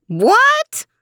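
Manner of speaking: very high screechy question, rising; hard-of-hearing old lady's screech; screechy old woman. A narrator's reading. Old woman who cannot hear the man, high and screechy. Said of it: "It was high and screechy".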